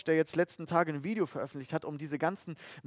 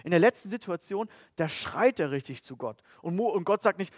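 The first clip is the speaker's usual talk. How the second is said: ordinary talk, nothing marked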